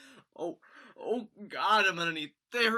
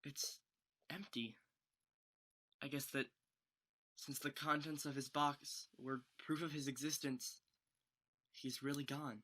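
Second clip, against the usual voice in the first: much softer, lower, subdued; hushed and grieving; hushed grief; 5 semitones lower, 12 dB quieter than usual. Acted. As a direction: say it quietly, stunned by the loss. Finding spirit's box empty.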